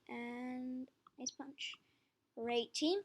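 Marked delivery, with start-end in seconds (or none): 0.1-1.8 whispering. Real, not acted. Quietly editing team.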